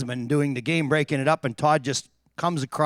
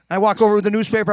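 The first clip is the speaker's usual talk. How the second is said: usual speaking voice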